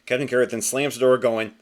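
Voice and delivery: normal talking voice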